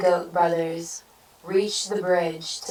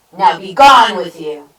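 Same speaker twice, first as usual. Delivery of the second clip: loud dismissal; 13 dB louder than usual. A narrator's reading. Troll dismisses the goat.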